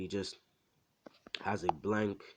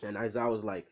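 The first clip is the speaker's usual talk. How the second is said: ordinary delivery, nothing marked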